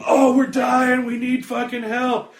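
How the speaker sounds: high, loud, drawn-out cry for help; hammed-up shout from the dying; mock desperate plea. Acted plea from dying characters.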